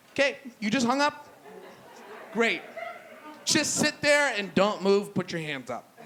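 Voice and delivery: rising, exasperated orders to a panicking friend. Retold or imitated. Acted exasperation on a botched emergency call.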